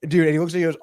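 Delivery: ordinary talk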